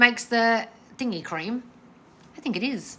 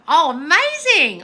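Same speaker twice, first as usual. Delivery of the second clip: loud, slow, higher oh amazing; excited; 8 semitones higher, 8 dB louder, slower than usual. Real, not acted. Host cheering a viewer who quit her job, excited. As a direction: cheer them on warmly.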